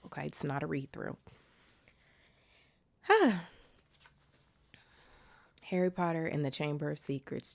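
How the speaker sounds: plain reading voice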